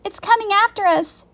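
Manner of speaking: high, loud warning; alarmed cry. A narrator's reading. Girl sees giant octopus approaching, alarmed cry. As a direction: raise the voice in sudden alarm.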